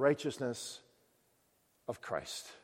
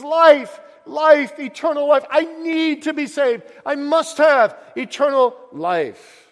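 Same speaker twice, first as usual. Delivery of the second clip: loud, high, desperate shout; crying out; 12 semitones higher, 13 dB louder, slower than usual. Acted. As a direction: shout it as you run away.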